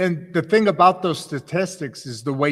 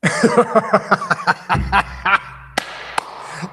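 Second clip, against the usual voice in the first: real laugh; 5 semitones higher, 5 dB louder than usual. Real, not acted. Host reacts to the Boston line.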